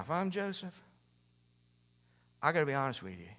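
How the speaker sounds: ordinary talk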